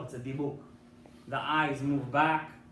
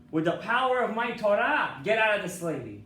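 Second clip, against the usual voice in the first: loud, higher-pitched command to the spirit; commanding exorcism; 6 semitones higher, 7 dB louder than usual. Acted. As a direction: order it out with authority. Rabbi commands the dibuk.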